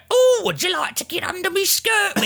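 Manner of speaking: very high, campy mock wench voice; campy stage wench. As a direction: ham it up like a lurid stage tart. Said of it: "way more campy and way more lurid".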